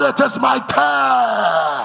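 loud, slow, drawn-out shout; shouted declaration. Real, not acted. Preacher leads the church in a loud shout. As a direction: shout it out with the crowd.

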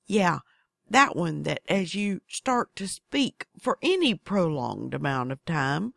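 usual speaking voice

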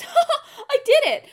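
high, loud cackling glee; triumphant laugh. Retold or imitated. Mocking the hero's loud laughing victory. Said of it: "he looks at his sword and he goes".

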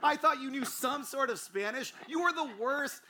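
high, loud mock outrage; exasperated scolding. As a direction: holler it like an appalled friend telling someone off. Acts friend's exasperated scolding.

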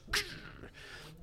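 a high breathless squeak of a body jolting; imitated jolt noise. Shown as a demonstration. Showing how your body reacts to the electric shock, a high breathless squeak. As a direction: make the noise of getting zapped. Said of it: "your whole body goes".

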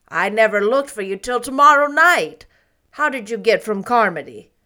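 a hint of a brisk, startled aunt; Marilla's voice. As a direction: glad but gruff greeting.

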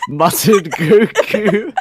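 ordinary talk

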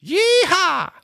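high, loud cowboy whoop; cowboy accent. Pretend. Quizmaster giving a clue to the player, mock cowboy accent. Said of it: "nearly as good as your Welsh accent".

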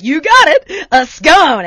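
loud, exasperated mock outburst; irritated. Irritated customer answering bakery woman, loud exasperation. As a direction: raise pitch and volume, fed up. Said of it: "I'm getting irritated".

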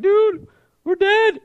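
high, slow, hammed-up dread; panicked servant. Preacher imitating Elijah's servant seeing the army, mock panic. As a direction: play the terrified sidekick.

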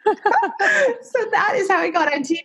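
high, laughing through the punchline. Real, not acted. Guest laughs at herself while telling the host how she got on TV. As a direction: giggle it out, half-crying with laughter.

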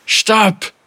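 high, loud cry to stop; desperate shout. Acted. Captain silencing the accusing voices, desperate shout. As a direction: cry out against tormenting voices.

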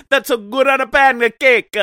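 loud, high goofy mock shout; hammed-up shout. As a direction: belt "that's a good on a pancake" like a cartoon. Hammed-up repeat of joke.

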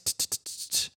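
soft unvoiced "t, t, t" taps; repeated T sounds. Shown as a demonstration. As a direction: tap out the T sound. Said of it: "The T doesn't become a t".

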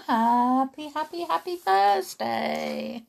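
high, bouncy singsong; sing-song greeting. Shown as a demonstration. Wishing young listeners happy Thursday, high singsong. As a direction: chirp it out playfully.

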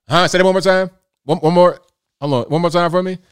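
loud, high asking to repeat; loud straining ask. Retold or imitated. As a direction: call out over the muffled talk. Straining to hear masked talker.

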